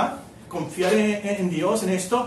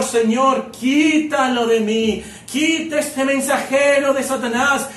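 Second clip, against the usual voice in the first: louder, desperate begging to the Lord; preacher voicing Paul's tearful prayer, louder and urgent; anguished pleading prayer; 3 semitones higher, 5 dB louder than usual. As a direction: plead like a man on his knees in pain. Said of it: "llorando con mucho dolor".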